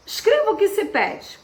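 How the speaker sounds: ordinary talk, nothing marked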